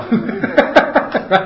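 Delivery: a burst of high, loud laughter. Real, not acted. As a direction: let out a big real laugh. Shared joke about Army.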